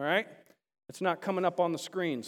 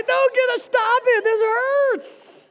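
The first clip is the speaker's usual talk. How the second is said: high, loud cry of pain; hammed-up yell to make it stop; acted protest; 8 dB louder, slower than usual. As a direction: wail as if the weight is too much. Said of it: "All right, it's like".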